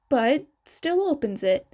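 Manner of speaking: ordinary delivery, nothing marked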